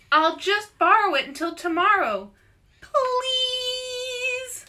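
high sweet voice, sings out the last please; pleading girl. A narrator's reading. Louise begging Arnold to lend her the treasure, sing-song pleading.

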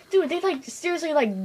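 normal talking voice

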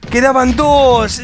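drawn-out anguished shout; frustrated shout. Real, not acted. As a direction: yell it in despair. Game loss outburst.

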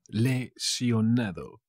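"Lesionado" stretched out in parts; slow, syllable by syllable. Shown as a demonstration. Teacher repeats the Spanish for injured, slowly broken up. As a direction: say "Lesionado" slowly, le, sio, nado.

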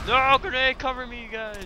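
urgent high yell for cover; cover me shout. Real, not acted. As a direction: shout to the squad to cover you. Calling teammates in firefight.

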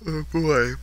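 talking through a yawn. Real, not acted. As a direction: yawn while you say it. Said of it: "I cannot stop yawning".

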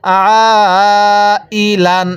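very long held "আ", then short "ই, লান"; drawn-out vowel. Shown as a demonstration. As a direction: sustain "আ" before finishing "ই, লান". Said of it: "এরকমভাবে শিখব".

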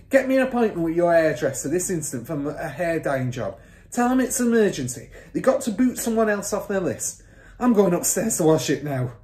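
loud, high, urgent orders; father's shout. A narrator's reading. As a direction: fire off orders in a hurry. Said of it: "the father shouted".

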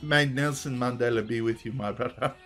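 mock-solemn South African blessing; South African accent. Blessing my brother, in South African accent. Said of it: "Nelson Mandela without it sounding racist".

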